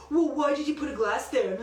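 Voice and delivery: mock the husband's blaming tone; mimicking husband. Wife imitating husband blaming her for the glass, light mockery. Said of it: "And this is how he talks".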